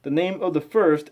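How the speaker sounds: slow, measured scripture reading. Acted. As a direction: read it out like a careful lesson.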